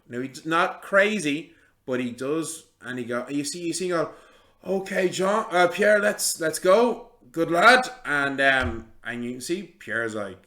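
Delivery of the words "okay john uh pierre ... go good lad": higher, louder voice of a man holding a load; lifting and urging. Acted. Wagon lifter telling Pierre to go, raised urgent call. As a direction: call to your helper mid-lift.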